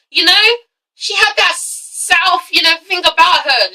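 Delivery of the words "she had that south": slow, higher mock Southern drawl. Vlogger mimicking a reality star's Southern twang, drawled. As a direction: stretch it out like a Southern belle. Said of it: "'cause she's Southern".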